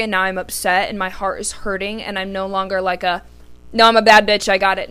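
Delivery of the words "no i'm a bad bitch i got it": loud, cocky self-assurance; confident bravado. Retold or imitated. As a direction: boast it like nothing hurts. Voices her tough front.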